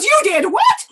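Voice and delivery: high, loud shocked cry on What; shocked disbelief. A narrator's reading. Yelena hearing her frog skin was burnt, shocked cry. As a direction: gasp it out, stunned. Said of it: "She goes".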